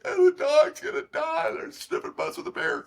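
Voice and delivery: high panicked yelp of alarm; shrill alarm, voice jumping way up; panicked phone call. Retold or imitated. Calling his wife in a panic about the bear. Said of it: "So I call Chris, like".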